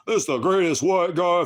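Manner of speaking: high, loud sneering mimicry; mocking viewer. Streamer mocking a chat comment, high loud sneer. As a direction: parrot the viewer in a silly high voice.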